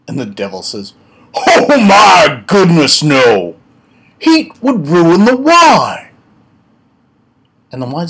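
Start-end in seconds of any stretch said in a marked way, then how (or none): 1.3-6.1 high, loud, slow outburst; mock horrified shout. Devil aghast at the wine snob's question, hammed-up shout. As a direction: shout it as if hot is unthinkable.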